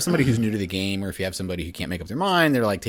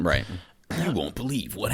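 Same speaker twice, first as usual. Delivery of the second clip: clickbait video narrator. Retold or imitated. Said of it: "so many Watch Mojo videos about".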